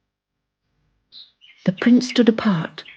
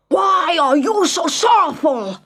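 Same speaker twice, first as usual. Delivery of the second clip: high, forceful shout; angry demand; 9 semitones higher than usual. Acted. Prince shouting at his sad subjects, angry. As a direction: demand it from horseback, furious.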